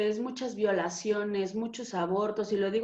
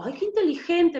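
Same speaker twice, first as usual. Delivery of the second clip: higher, louder, amazed "Ay, qué inteligente"; amazed self-praise; 7 semitones higher, 4 dB louder than usual. Retold or imitated. Telling how she reacts to the inner voices, amazed. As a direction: gasp at your own cleverness, half mocking. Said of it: "cuando yo digo".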